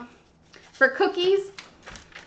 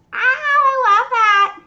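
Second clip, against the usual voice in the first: delighted gush; 6 semitones higher, 7 dB louder, slower than usual. Real, not acted. Loves follower's name.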